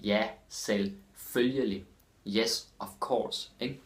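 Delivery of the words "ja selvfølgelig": slow, full syllables in "selvfølgelig"; slowly. Shown as a demonstration. Teacher showing Danish learners how to say it, slowly. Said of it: "Or slowly".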